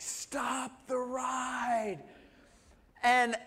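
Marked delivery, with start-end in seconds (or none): 0.0-2.0 slow, drawn-out pleading cry; a kid's stretched-out plea to stop the ride; desperate plea. Retold or imitated. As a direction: beg the ride to stop, stretching each word. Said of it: "I'm like".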